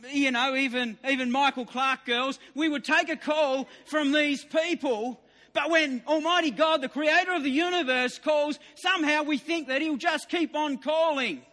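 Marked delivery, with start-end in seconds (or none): 5.5-8.6 emphatic. Real, not acted. Preacher building to climax.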